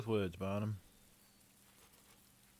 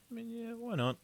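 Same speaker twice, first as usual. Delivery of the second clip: mock-cheerful, pitched way up; sarcastic; 14 semitones higher than usual. Player mocking the game's shady investment offer, sarcastic. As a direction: toss it off like an obviously bad idea.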